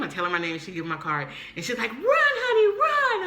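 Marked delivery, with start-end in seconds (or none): 1.9-3.3 very high, loud mock holler; playful high-pitched shout; urgent cheering yell. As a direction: yell to hurry a runner along. Urging her to run, retold.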